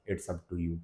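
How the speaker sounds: relaxed low-key model line; soft casual. Shown as a demonstration. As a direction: murmur it casually to a friend. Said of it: "पहला तरीका".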